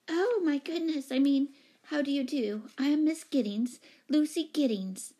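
high-pitched, fussy introduction; flustered lady tenant. Acted. Startled tenant greeting the new owner, flustered.

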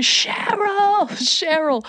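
high, drawn-out call of a name; high wail at normal volume; mock screaming. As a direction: imitate a man hollering after someone. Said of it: "he's still screaming".

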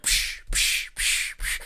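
sharp hissing "psh" bursts; imitated scary music. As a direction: imitate horror-score stings with the mouth. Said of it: "they start playing scary music".

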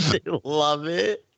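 high, slow, helpless repeat; real laughter. Real, not acted. Friend echoing the cheese story, cracking up. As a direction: barely get it out for laughing.

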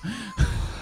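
high squealing laugh; a real squeal of laughter at the punchline. Real, not acted.

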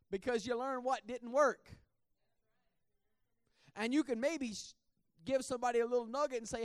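loud, high, fervent preaching; preaching at full force. Acted. Preacher urging the church to mentor others, loud fervent preaching. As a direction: rouse the congregation.

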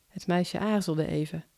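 ordinary delivery

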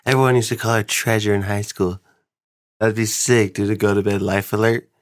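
ordinary delivery

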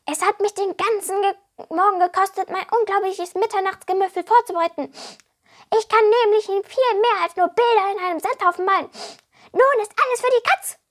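high, loud little kobold voice; boastful kobold. A narrator's reading. Kobold boasting and complaining to Alea, high and loud. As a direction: brag like a puffed-up goblin.

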